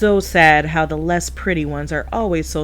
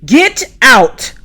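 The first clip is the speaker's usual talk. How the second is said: loud, high shout to get out; angry yell; 6 semitones higher, 7 dB louder than usual. Acted. Stacy yelling at Pat to leave the room. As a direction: throw her out with a yell.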